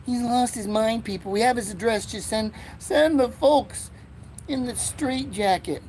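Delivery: mock voice. Mock announcer about himself.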